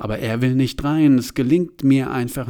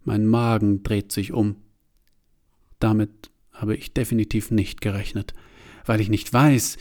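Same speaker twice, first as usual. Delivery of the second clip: ordinary delivery, nothing marked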